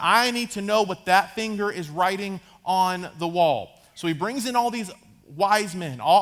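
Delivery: ordinary talk, nothing marked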